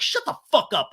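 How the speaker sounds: high retold snap; angry interruption. Lala silencing Sandoval, high mock anger. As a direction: cut them off in mock anger. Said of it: "And Lala's like".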